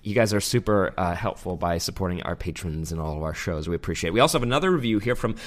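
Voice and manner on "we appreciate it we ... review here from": usual speaking voice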